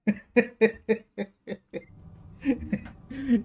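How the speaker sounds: loud high cackling laugh falling away; high loud laughter. Real, not acted. Streamer cracking up at her joke to chat. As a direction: let a big high laugh tumble out.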